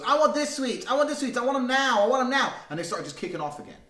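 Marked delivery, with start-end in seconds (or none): 0.0-2.5 put-on high, louder whiny child demanding sweets; whining little kid. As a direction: play a bratty four-year-old throwing a tantrum. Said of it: "the little one goes".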